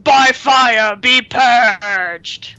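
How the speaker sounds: slow, drawn-out fire boss decree; stretched-out villain proclamation; Ragnaros. Acted. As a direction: declaim it slowly like a game boss. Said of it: "gotta be that Ragnaros".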